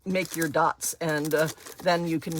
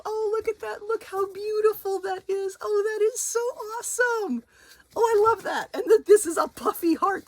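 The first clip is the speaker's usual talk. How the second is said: excited squeal. Real, not acted. Gushing over a gift card.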